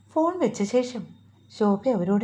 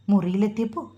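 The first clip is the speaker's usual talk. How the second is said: usual speaking voice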